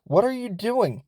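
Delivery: a mild imitation of a worried mother calling out; startled mom. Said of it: "and she's like".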